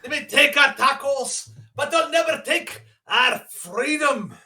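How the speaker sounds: high, loud and slow, rolled R's; Braveheart battle cry in a Mexican accent. Pretend. Redoing the freedom speech, hammed-up with rolled R's. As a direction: declaim it like a rebel leader, rolling the R's. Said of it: "Gotta roll the R's".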